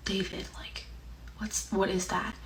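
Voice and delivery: near-whisper, imitating an uneasy mom; hushed, uneasy. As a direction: drop to a very soft, cautious whisper. Retelling mom's uneasy hushed question.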